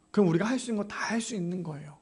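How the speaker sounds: quick, breathy whispered aside; hushed, hurried whisper to the congregation. Real, not acted. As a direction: lean in and whisper it fast.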